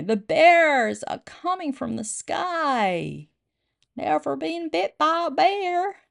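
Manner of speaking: high, slow, hammed-up American accent; exaggerated high-pitched American sailor accent. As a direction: draw it out in a put-on American accent. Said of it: "I've gotta say that in American accent".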